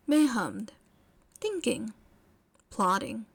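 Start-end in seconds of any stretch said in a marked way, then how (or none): none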